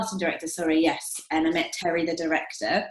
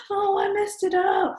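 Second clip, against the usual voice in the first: high, drawn-out wail of defeat, much higher; hamming up the girl who blew it; mock despair; 12 semitones higher, slower than usual. Ringing her boyfriend after the audition, a high mock wail. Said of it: "ringing my boyfriend being like".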